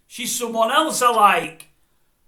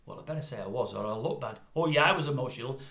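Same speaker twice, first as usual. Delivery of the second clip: reluctant interviewee thought; 9 semitones lower, 7 dB quieter, faster than usual. Retold or imitated. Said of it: "And they'll think".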